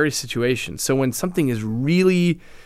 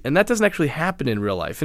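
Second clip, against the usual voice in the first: usual speaking voice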